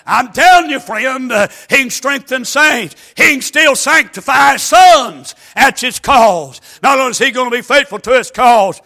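high, loud, shouted sermon cadence; full-force preaching. Acted. Preacher to congregation, loud shouted preaching. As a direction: drive the scripture home with everything you've got.

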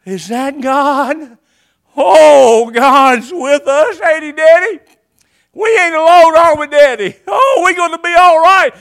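put-on a child's awe turning to relief; excited little girl. Little girl seeing God's cloud, loud and high with joy. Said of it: "And she goes".

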